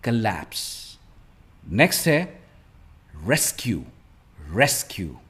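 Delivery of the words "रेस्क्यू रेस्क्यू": Rescue stressed on RES; first-syllable stress. Shown as a demonstration. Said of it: "stress is on the first part". (Rescue में पहले हिस्से पर ज़ोर)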